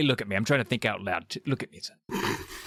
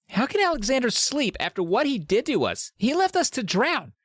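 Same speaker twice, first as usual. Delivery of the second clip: Sheena's outrage; 13 semitones higher than usual. Retold or imitated. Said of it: "Sheena's like".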